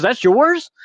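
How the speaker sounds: shocked it's his truck; surprised. Retold or imitated. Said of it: "And the guy goes".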